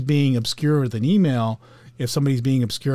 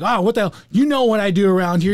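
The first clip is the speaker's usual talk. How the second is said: higher and louder, an imitated outburst at a coworker; mock angry yelling; 7 semitones higher, 6 dB louder than usual. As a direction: play the anxious type blowing up, half-acted. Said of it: "yelling and screaming".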